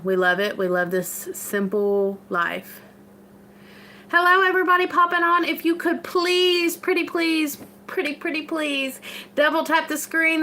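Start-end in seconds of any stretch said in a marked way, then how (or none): 4.1-5.5 high, fast, louder hello; excited greeting. Real, not acted. Greeting new viewers. 5.5-10.4 high, pleading pretty please; sweetly insistent. Real, not acted. Begging for likes.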